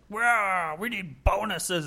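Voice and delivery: mocking whine. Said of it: "when people are like".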